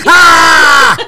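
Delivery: sudden high yelled Ah; mock shouting. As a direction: let out a loud fake shout. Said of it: "Unless you're shouting".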